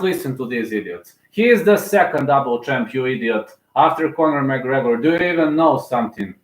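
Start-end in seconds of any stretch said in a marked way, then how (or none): none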